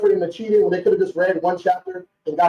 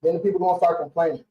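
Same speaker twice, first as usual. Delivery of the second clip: ordinary talk, nothing marked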